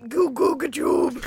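high, loud, wild bellow; walrus bellow. A narrator's reading. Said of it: "The walrus bellowed wildly".

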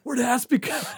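mock excited chase. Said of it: "We're like".

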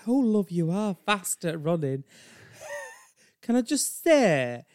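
high Yorkshire gushing after a chase; puffed, pushy Yorkshire woman; sigh, then coo in broad Yorkshire; Brenda. Acted. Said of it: "she has such a strong Yorkshire accent".

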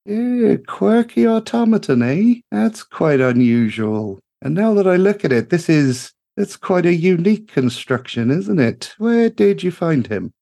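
bright-eyed gnome engineer calling out in delight; gushing gnome mechanic. Acted. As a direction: sing it out like a cheery little tinkerer. Greta curious about Tok.

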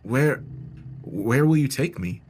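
mildly nervous, halting question; nervous stutter. A narrator's reading. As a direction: falter on the first word, then ask uneasily. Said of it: "stuttering my way through my reply".

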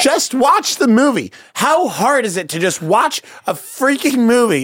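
high-pitched exasperated rant; worked-up, emphatic complaint; ranty. Real, not acted. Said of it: "yours was so ranty".

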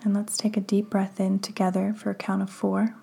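hushed, unhurried breathing cues; guided meditation. Acted. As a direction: ease the listener into calm, breath by breath.

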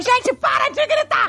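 high, drawn-out mock mum yell. Mum to her shouting sons, high-pitched yelling. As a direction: scream over the kids to stop the screaming. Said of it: "você gritava com eles pra eles pararem de gritar".

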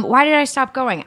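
normal talking voice

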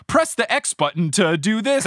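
high, loud, matter-of-fact game instruction; imitating Ron. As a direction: pitch it up like an overeager video game helper. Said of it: "Ron just straight up says things such as".

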